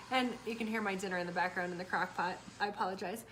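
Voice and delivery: ordinary talk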